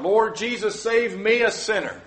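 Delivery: high, loud desperate plea; crying out to God. Shown as a demonstration. Preacher voicing a sinner crying out, loud plea. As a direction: cry it out to the Lord for mercy. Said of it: "I cry out".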